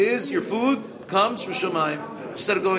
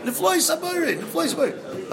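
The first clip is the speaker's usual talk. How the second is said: awed exclaiming; 10 semitones higher than usual. Acted. Awe repeated.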